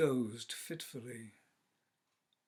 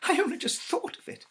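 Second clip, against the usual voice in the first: high, loud and fast, half laughing; hysterical; 10 dB louder, faster than usual. Acted. Wife tells her husband her idea, high and hysterical. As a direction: gabble it out in frantic glee. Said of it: "she said hysterically".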